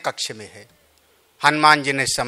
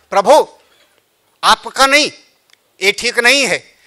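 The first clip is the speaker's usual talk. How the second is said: high, loud eager plea; eager Lakshman; 7 semitones higher, 6 dB louder than usual. Acted. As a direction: urge the lord to let you act. Lakshman voiced in katha.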